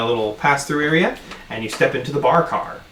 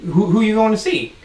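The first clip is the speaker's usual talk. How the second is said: lightly voiced nosy bartender; 5 semitones higher than usual. Bartender curious about their trip.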